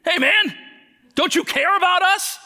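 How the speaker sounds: high, loud panicked yelling; hammed-up frantic shout of scared kids; mock screaming kids. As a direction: yell it like terrified children waking dad. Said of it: "they start screaming".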